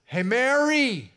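put-on loud, high call across a crowd for a lost child. Parent calling for a lost child in a crowd, loud high call. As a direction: shout the kids' names, searching for them. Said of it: "calling out".

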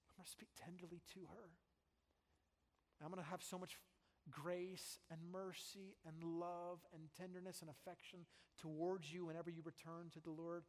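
soft, hushed promises; tender promise. Acted. Preacher voicing God speaking tenderly, hushed. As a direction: speak it gently, like wooing back. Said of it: "He says".

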